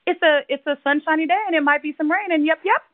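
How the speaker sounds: high, chirpy mock newscaster; hammed-up cheery weather girl; imitating the news lady. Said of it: "this lady is doing the news".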